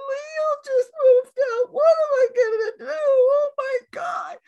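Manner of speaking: high, loud tearful wail; high mock crying, voice wobbling; retold crying. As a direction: imitate yourself sobbing over the kid leaving. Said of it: "I said".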